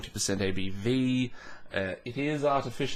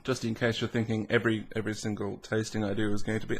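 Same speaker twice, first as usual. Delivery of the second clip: ordinary talk, nothing marked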